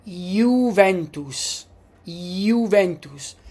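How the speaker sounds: slow "Juventus" with a Y sound, yoo-VEN-toos; "Juventus" said slowly with Y onset; "Juventus" drawn out, yoo-ven-toos; Italian Juventus. Shown as a demonstration. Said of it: "How do we pronounce this word?".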